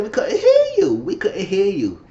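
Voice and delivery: sarcastic we-can't-hear-you jab; hammed-up retort to a muttered dig; mock retort. Others retort to mumbled remark.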